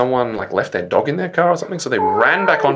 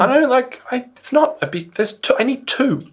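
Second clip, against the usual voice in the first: exasperated; 11 semitones higher than usual. Real, not acted. Exasperated about reusable bags.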